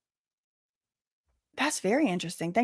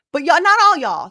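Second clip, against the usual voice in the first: higher, louder correction; emphatic correction; 7 semitones higher, 9 dB louder than usual. Real, not acted. Streamer correcting chat, louder and higher. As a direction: call out not all y'all.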